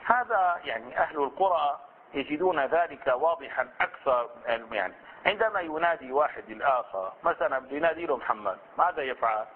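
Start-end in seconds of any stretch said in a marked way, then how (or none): none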